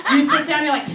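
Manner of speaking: ordinary talk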